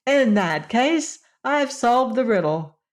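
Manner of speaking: put-on a girl who just solved the riddle, bursting with glee; gleeful cry. A narrator's reading. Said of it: "cried Scraps, dancing with glee".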